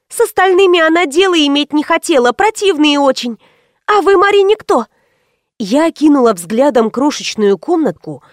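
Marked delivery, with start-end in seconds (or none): 0.1-4.9 put-on high-pitched girl's voice; naive girl Анжела. A narrator's reading. Анжела asking who the visitor is, high-pitched and naive.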